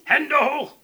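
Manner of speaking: high, loud police shout; sharp, high yell; called-out police command. A narrator's reading. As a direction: shout it like officers making an arrest. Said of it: "riefen sie, wie sie es gewohnt waren".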